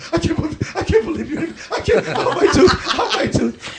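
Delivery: hammed-up pained squeal; mock beaten-up whimper. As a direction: play yourself getting punched in the mouth, squealing. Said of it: "I sound like that when they're beating me".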